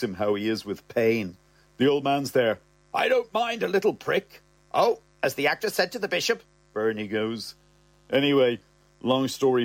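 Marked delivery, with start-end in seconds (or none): 2.9-4.4 put-on way up high, delighted old man; the old man, chuffed, in a high piping voice; old man's squeaky voice. Old dad to son, thrilled: high piping. 4.7-6.4 quick, higher woman's voice; Bernie's quip. Acted. Bernie teasing the old man: quick higher quip.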